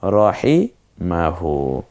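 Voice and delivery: রহিমাহু, very slow, with a short heavy র; slow correct Arabic. Shown as a demonstration. Teacher models রহিমাহু slowly as correct. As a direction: stretch রহিমাহু, keeping র short. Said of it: "রাহিমাহু না হয়ে হবে".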